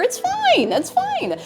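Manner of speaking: very high, squeaked-out insistence; squeaky mock reassurance. Streamer joking away worries about going bald, squeaky insistence. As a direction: squeal it as if barely keeping composure.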